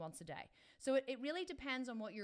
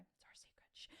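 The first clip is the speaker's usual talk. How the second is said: playful unvoiced whisper; 20 dB quieter than usual. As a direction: hush it like a shared secret. Sharing a secret with viewers.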